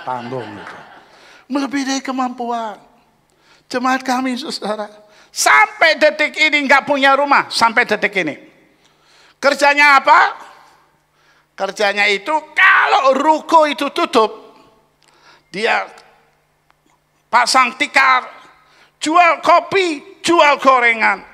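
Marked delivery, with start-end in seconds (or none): 1.5-2.8 shouted refrain. Acted. 3.7-14.3 loud, high preaching shout; preaching at full force. Acted. Pastor thunders to the congregation about a poor member. 15.5-21.2 loud, high and slow; forceful preaching. Acted. Pastor stresses the poor member's night work, loud and slow.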